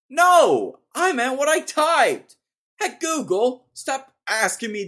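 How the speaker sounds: high, loud indignant shout; yelling at Google. Real, not acted. Annoyed at Google's suggestions, an indignant shout. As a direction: yell back at the search engine.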